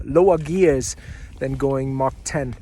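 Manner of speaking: ordinary delivery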